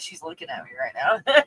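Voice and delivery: ordinary talk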